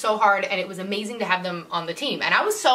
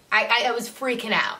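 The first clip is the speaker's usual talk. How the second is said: ordinary talk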